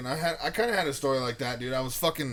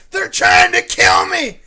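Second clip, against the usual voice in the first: loud, high, drawn-out cry of terror; retold screaming; 10 semitones higher, 14 dB louder, slower than usual. Panting stranger telling teens he's hunted, screaming. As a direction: yell it slowly, imitating a hunted man. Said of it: "He kept screaming, screaming".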